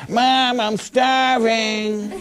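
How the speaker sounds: put-on whiny child. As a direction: stretch out "starving" like a whining kid. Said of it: "you ever had your kids say".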